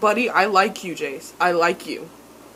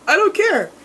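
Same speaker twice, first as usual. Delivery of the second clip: high, louder mock of a bratty kid; Max's flat dismissal; 11 semitones higher, 7 dB louder than usual. Mimicking Max brushing off his brother, high and bratty. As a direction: toss it off like a sullen child. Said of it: "and then he says".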